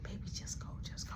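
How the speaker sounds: urgent unvoiced whisper. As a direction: whisper at him to leave. Girlfriend whispering to him.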